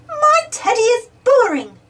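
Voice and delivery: put-on high, whiny child complaint; sulky little piglet voice; snorting sulk. Peppa complaining to Mummy, sulky high whine. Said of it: "Peppa snorts".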